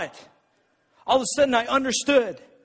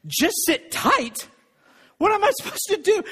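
mock disbelief; 7 semitones higher than usual. Pastor mocking leaders' email to the congregation, incredulous. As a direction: scoff at the advice, then plead. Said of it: "mocking the email".

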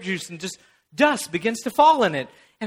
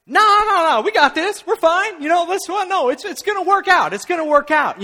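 higher, breezy no-worries friend voice; carefree friend; 6 semitones higher, 3 dB louder than usual. Retold or imitated. Friend insists the plan will work, breezy and higher. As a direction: brush off every doubt cheerfully.

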